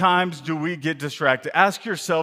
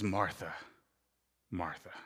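tender call; 8 semitones lower, 12 dB quieter than usual. Acted. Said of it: "he's tender, but yet he's pointed".

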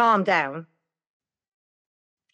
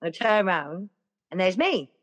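ordinary delivery, nothing marked

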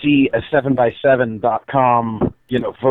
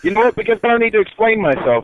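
playful high defiance; 9 semitones higher, faster than usual. Real, not acted.

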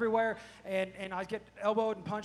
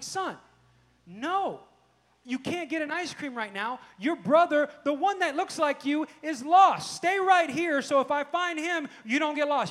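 raised, louder stern dad voice; exasperated scolding; 7 semitones higher, 10 dB louder, slower than usual. Retold or imitated. Dad to son wanting ice cream while his brother is lost, exasperated scolding. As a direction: lay down the law to a clueless kid.

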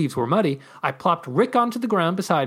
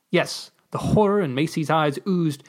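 usual speaking voice

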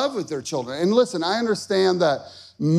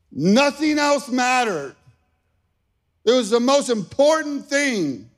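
high, insistent preaching build; raised, fervent storytelling at the climax; impassioned urgency; 7 semitones higher than usual. Real, not acted. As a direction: drive each phrase home, pitched up. Urgent climax of lost-son story.

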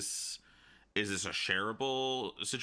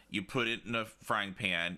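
usual speaking voice

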